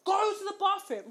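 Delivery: a toned-down frantic order; imitated shouting. Boyfriend ordering girlfriend to bathroom, imitated shout. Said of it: "He's shouting at me".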